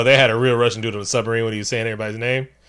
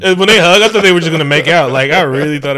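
higher, louder, fast; excited; 3 semitones higher, 7 dB louder than usual. Real, not acted. Telling cohost excitedly about the hug. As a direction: rush it out excitedly.

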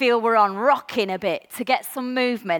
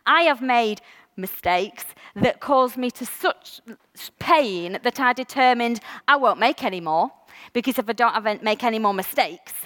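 ordinary delivery

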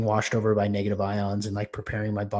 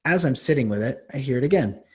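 ordinary talk

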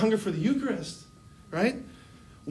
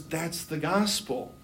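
slow, softer, understated certainty; calm wise mentor; 3 semitones lower, 5 dB quieter, slower than usual. Retold or imitated. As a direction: quietly state it as a spiritual director. Wise director repeats his quiet answer.

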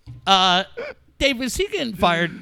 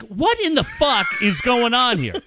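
exasperated disbelief; 3 semitones higher than usual. Retold or imitated. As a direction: react in raised-pitch disbelief. Retold outrage at the radio.

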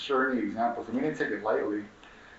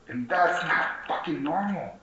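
higher, slow, every word hammered; slow emphatic outrage; 6 semitones higher, slower than usual. Real, not acted. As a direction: insist on it, slowing down. Angry emphasis.

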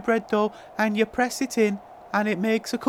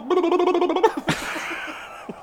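rapid burbling babble, higher; burbling noise; 5 semitones higher than usual. Shown as a demonstration. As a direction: burble wildly, then let it fade. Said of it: "I wanna go".

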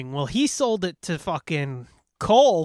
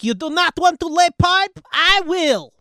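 put-on high, loud heavy accented challenge; hammed-up Slavic tough woman; mock foreign accent; 11 semitones higher, 6 dB louder than usual. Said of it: "She's like".

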